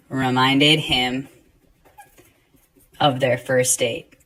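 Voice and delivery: slow read, pausing at each dash; teacher dictating the movie sentence, slow and spaced; slow dictation read. Shown as a demonstration. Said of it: "See how I read that?".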